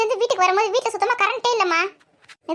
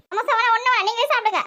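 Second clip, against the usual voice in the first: high-pitched sulky protest; storming off in a huff; huffy refusal; 5 semitones higher than usual. Acted. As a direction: refuse in a raised, offended pitch. Daughter storms off refusing snack.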